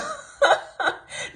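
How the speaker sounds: a burst of high laughter; amused high laugh. Real, not acted. Laughing at own mock.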